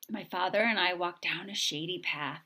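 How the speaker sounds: ordinary delivery, nothing marked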